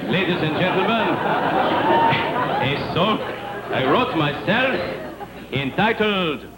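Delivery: hammy fake French crooner; fake French accent. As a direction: play a corny French singer, broken English. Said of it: "the great fake French singer".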